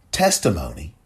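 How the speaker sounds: usual speaking voice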